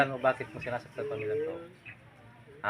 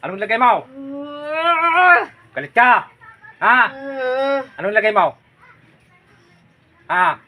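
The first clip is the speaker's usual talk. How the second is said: louder, higher harsh questioning; angry interrogation; 11 semitones higher, 12 dB louder than usual. Real, not acted. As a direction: press the spirit hard. Healer interrogates spirit angrily. (galit na pagtatanong)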